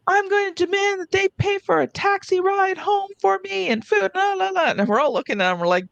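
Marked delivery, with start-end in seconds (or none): 0.1-4.7 entitled demanding passenger. Retold or imitated. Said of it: "he's like".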